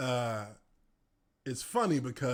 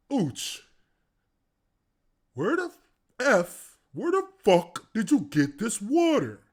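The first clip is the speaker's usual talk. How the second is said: loud, higher angry demand; angry coach; 6 semitones higher, 7 dB louder than usual. Retold or imitated. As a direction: bark at him, furious. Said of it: "He says".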